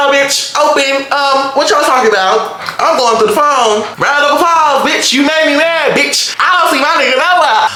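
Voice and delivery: high-pitched, worked-up; hyped snooping. Real, not acted. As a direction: get hyped digging through the phone.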